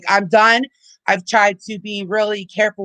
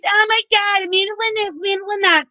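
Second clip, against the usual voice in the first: much higher, squeaky mocking whine; mocking fake outrage; 11 semitones higher than usual. Streamer mocking Tiffany's fake outrage, high sneering parody. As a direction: sneer as you parody her. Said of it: "you're fake outrage".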